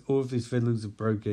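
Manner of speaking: plain reading voice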